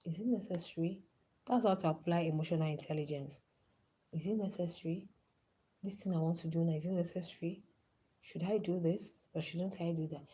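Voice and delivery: quiet self-questioning. Retold or imitated. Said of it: "you're asking yourself".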